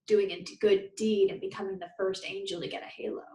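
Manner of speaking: ordinary delivery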